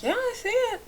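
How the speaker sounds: high, soft, airy put-on husband voice; lightly mocking a too-calm husband; unbothered husband. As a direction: shrug it off in a thin soft voice. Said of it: "he'd be like".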